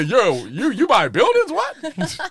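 squeaky excited disbelief, voice jumping way up; high mock surprise. Mocking overheard caller, high laughing.

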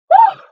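a high, loud excited whew. Real, not acted. Host overwhelmed by listener love, excited high whew. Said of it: "jeez, I'm excited".

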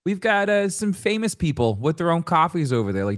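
ad read in a put-on Long Island accent. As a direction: sell coffee like a Long Island local. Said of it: "Long Island accent".

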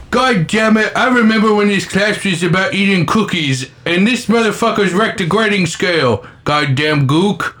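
high-pitched ranting Australian impression; Australian accent. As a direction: rant in a put-on Australian accent. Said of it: "an Australian accent, let's just say".

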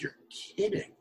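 a stunned hush of surprise; hushed disbelief. Retold or imitated. Boss hearing he quit for TV, hushed and stunned.

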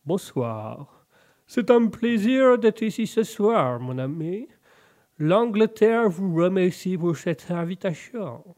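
put-on slow, terrible English accent; drawn-out mock English lord in French. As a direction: greet formally in a bad English accent. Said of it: "j'ai un accent anglais, euh, pourri".

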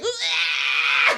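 imitated high-pitched "ah" shriek; mimicked crazed yelp; weird scream. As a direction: screech like a wild animal. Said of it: "weird noises and screaming and shit".

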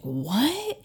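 high, disbelieving question; retold shock. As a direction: act out being stunned by news. Retold shock at surgery news.

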